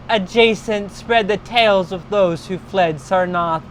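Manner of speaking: ordinary delivery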